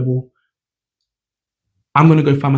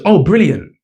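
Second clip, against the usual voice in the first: pleased surprise; 5 semitones higher, 4 dB louder than usual. Retold or imitated. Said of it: "He goes".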